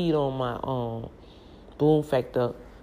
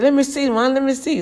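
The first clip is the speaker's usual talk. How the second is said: eager daughter; 11 semitones higher, 6 dB louder than usual. Retold or imitated. Daughter's eager request retold.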